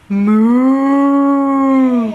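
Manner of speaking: playful cow moo. Pretend. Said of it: "What's a cow say?".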